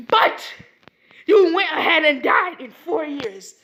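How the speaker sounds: high, loud scolding yell at the reckless brothers; scolding shout. Retold or imitated. The Sage scolding Indra and Ashura, high loud shout. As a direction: bark it like an exasperated elder.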